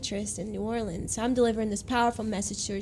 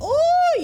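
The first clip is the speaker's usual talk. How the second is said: very high, loud swooning ooh; high ecstatic ooh; 8 dB louder than usual. Retold or imitated. Woman overcome by the message.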